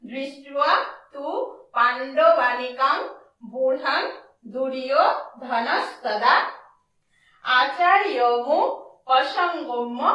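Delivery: verse words said slowly one by one; teacher summing up the pronunciation; clear recital. Shown as a demonstration. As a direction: read out each word slowly and clearly. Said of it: "তাহলে আমরা কি পেলাম?".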